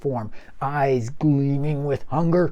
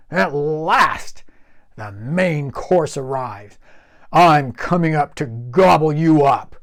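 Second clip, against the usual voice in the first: hungry troll; 3 semitones higher, 7 dB louder than usual. Acted. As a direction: savour the meal to come. Troll hungry for main course.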